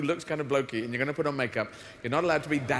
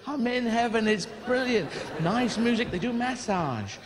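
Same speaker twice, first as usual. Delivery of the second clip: higher, bubbly woman calling down from heaven; chirpy Janine from heaven; 6 semitones higher than usual. Acted. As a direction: play a delighted housewife beaming down from the clouds. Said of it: "opened up the clouds and went".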